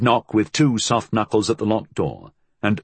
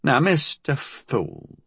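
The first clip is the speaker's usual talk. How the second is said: slow, higher, tightly held housekeeper voice; 4 semitones higher, much slower than usual. A narrator's reading. Housekeeper answers her employer Fool, tight and slow. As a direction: draw it out tightly, holding back objection. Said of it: "she said tightly".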